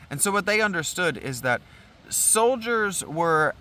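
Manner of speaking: ordinary talk, nothing marked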